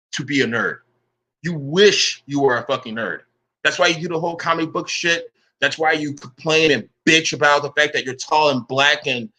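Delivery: normal talking voice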